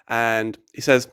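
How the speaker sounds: ordinary delivery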